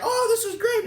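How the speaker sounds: very high, gleeful squeal; mock glee. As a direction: squeak it out with delight. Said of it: "the guy's like".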